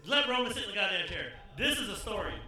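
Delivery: loud calling out; yelling. Real, not acted. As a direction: holler at him to come. Ordering Roman to sit.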